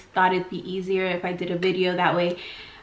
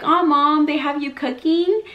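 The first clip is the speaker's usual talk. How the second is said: raised, higher voice of sympathy for mom; acted-out sympathy; 7 semitones higher, 3 dB louder than usual. Daughter to dieting mom found cooking, retold sympathy. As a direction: pitch it up in retold commiseration.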